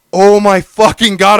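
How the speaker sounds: loud, slow, drawn-out exasperation; exasperated outburst. Retold or imitated. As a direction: act out being fed up, stretching every word. Retold quarrel, exasperated outburst.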